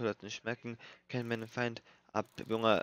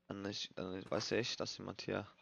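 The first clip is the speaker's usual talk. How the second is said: hushed hiding; 2 semitones lower, 8 dB quieter than usual. Real, not acted. Hiding quietly from killer.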